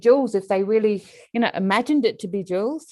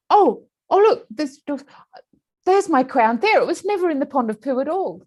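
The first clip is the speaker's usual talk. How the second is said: surprised delight; 7 semitones higher than usual. Acted. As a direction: gasp and point it out, high. Person spots the crown.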